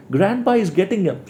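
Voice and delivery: high, insistent frightened repeat; scared girl. Acted. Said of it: "She said it again".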